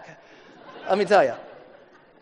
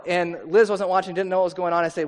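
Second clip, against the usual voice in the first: ordinary delivery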